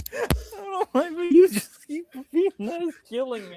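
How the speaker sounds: high, laughing through the words; helpless laughter. Real, not acted. Friend keeps repeating the joke, laughing squeal.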